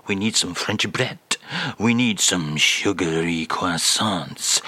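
a lightly done [name] with a French lilt, slowly; mock French [name]. [name] reads the French phrase to the host in a mock French accent. [name] lists his shopping.

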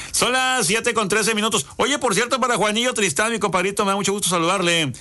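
high-pitched radio time check and shout-out; radio DJ announcing. Acted. Host greets a compadre on air, high radio DJ delivery. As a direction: pitch it up like a lively DJ.

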